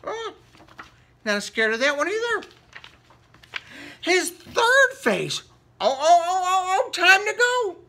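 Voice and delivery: high, loud rising panic; panicked alarm. Acted. Little boy panicking before the big crow, high and loud. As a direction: squeal it as the crow keeps coming.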